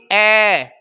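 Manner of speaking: ए stretched long as an open "ai"; wrong vowel demo. Shown as a demonstration. Teacher ruling out this sound, drawn-out vowel. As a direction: hold ए out emphatically, "aiii". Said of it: "ना कि".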